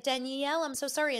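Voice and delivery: high, cooing sympathy; mock sympathetic friends. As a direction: coo it like a pitying friend. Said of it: "are just like".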